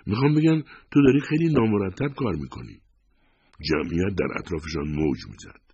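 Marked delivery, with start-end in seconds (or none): 0.1-2.8 high, tense reproach; agitated but trying for control; checking herself. A narrator's reading. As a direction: rein in the outburst, still pitched high. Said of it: "خودش را کنترل کرد".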